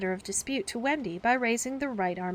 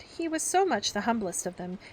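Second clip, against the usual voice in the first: ordinary delivery, nothing marked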